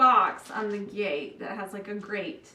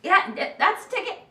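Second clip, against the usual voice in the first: pleased gate; 8 semitones higher, 4 dB louder than usual. Acted. Gate enjoys being oiled.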